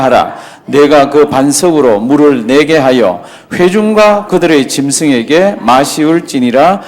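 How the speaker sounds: 천천히 또박또박 성경을 읽는 목소리; 말씀을 한 자 한 자 힘주어 낭독하듯. Acted. Reads scripture verses aloud. (느리고 엄숙한 성경 낭독)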